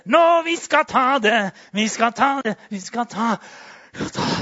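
high, loud rallying cry, fading; rally the troops in mock fervour; mock charging shout. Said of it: "jeg må skrike litt". (spøkefullt kamprop)

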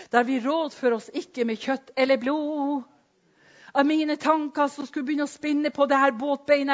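loud, high, driving sermon voice; fiery preaching. Acted. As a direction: preach it out at full force. Preaching at full force. (forkynnende preken med full kraft)

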